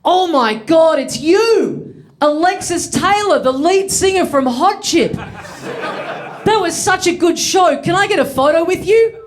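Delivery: high, gushing excited fan voice; starstruck fan. Retold or imitated. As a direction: gush like you've spotted a celebrity. Stranger gushing at mistaken celebrity.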